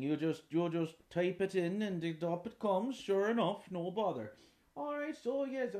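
put-on high, loud old Irish farmer, delighted; chuffed old countryman. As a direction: boast about what the grandson showed you. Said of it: "imagine the conversation that would have to happen".

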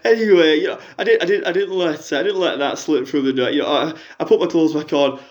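a lightly put-on middle-aged fan on the phone; a fan phoning in with a sob story, lightly voiced; Jackie caller voice. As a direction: play the hard-up caller, chatty and woeful.

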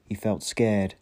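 ordinary delivery

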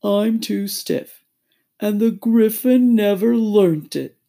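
loud, slow, regretful drawl; ponderous turtle voice; doleful Mock Turtle. A narrator's reading. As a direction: drag it out woefully. Mock Turtle excuses itself.

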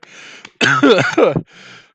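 real choking cough, loud; coughing up coffee; coughing fit. Real, not acted. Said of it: "went down the wrong pipe".

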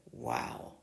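breathy whispered wow. A narrator's reading. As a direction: breathe it out in awe. Whispered awe.

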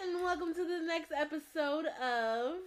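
high, loud announcer greeting; bright show-opening voice; podcast intro. Acted.

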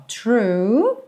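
"true" with a curled R and pursed lips; curled R, rounded lips. Shown as a demonstration. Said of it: "R também enroladinho e depois tem um biquinho".

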